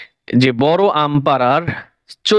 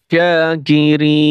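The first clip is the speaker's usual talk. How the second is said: slow drawn-out reading; much slower than usual. Shown as a demonstration. Teacher to students, showing how to blend the syllables, slow chanted reading. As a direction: stretch every syllable, very slow and sung out. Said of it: "বানান করে পড়বেন".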